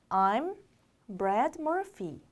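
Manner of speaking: slow, word by word with pauses; slow reading. Shown as a demonstration. Teacher modelling a name introduction for learners, slowly and separated. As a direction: read it out slowly, piece by piece. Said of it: "천천히 읽어볼게요".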